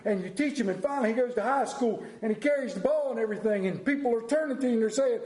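ordinary talk

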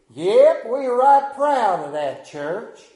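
proud small-town Southern cashier; southern drawl. Retold or imitated. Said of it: "he said in that deep southern drawl".

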